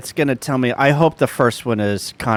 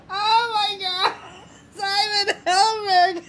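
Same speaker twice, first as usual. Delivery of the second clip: real helpless laughter, voice squeaking high; barely getting words out through a laughing fit; crying with laughter. Real, not acted. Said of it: "I'm crying".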